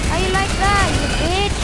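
high, loud taunting shout; gloating shout. Real, not acted. Gamer taunting the enemy he just killed, loud shout. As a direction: crow over the kill at full voice.

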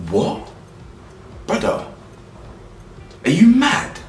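can't believe what he just heard; incredulous outrage. Real, not acted. Outraged reaction to the clip.

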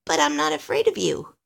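high little-elf voice; pleased discovery. Acted. Alistair grinning at Santa, pleased and brave. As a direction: declare it high, newly brave. Elf happily realizes he's unafraid.

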